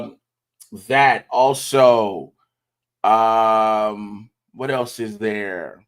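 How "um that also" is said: ordinary talk, nothing marked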